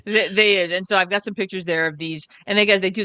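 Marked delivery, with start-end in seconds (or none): none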